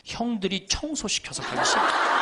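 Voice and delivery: slow, laughing imitation of a grumpy father; grumbling old father. Priest imitating his old father complaining to him, slow and laughing. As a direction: mimic an old father sulking, slowly.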